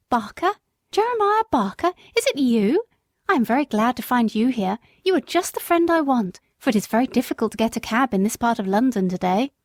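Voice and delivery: surprised lady. Acted. Former mistress recognises Jerry, pleasantly surprised. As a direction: greet an old friend gladly. Lady's voice.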